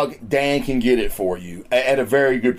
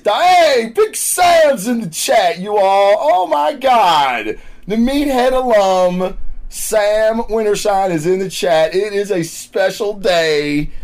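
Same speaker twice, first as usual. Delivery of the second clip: excited; 5 semitones higher, slower than usual. Real, not acted. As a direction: shout out a friend who just joined. Greets a guest in chat.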